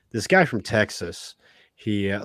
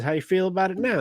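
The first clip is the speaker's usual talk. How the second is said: higher-pitched, lightly Southern devil; Southern accent; 8 semitones higher, faster than usual. Acted. Devil checking on Tex, light Southern accent. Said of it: "has a Southern accent".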